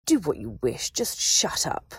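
cold, vicious dismissal. A narrator's reading. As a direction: cut her off viciously. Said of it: "his tone vicious".

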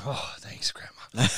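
soft whispered thanks. Retold or imitated. Boy whispering thanks to grandma. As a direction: breathe out relieved thanks.

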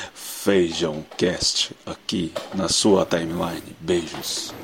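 slow, soft show sign-off; podcast sign-off. Pretend. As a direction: wind the show down gently. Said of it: "eu vou fazendo aqui o Feijão Cast".

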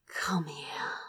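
unvoiced, aching whisper; whispered plea. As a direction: beg softly, near tears. Lover pleading in desire.

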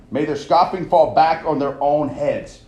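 loud, slow curse-like prayer; loud, slow preached verse; fervent scripture reading. Acted. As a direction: pronounce it like a judgment. Preacher reads scripture with force.

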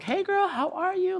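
put-on high, sing-out girly hello; effusive, high-pitched hello; gushing greeting. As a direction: greet a friend in a high squeal. Acted gushing greeting to friend.

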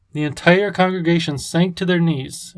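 usual speaking voice

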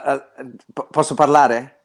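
usual speaking voice

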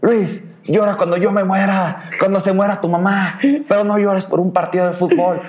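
high-pitched mock tirade; imitated furious dad. Dad berating his son for crying over football, imitated fury. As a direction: scold like a father at his wits' end. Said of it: "llegaba emputadísimo y me decía".

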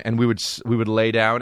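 ordinary talk, nothing marked

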